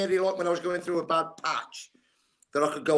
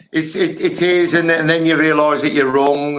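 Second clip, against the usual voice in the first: emphatic; 12 dB louder than usual. Real, not acted. Emphatic realisation of being wrong.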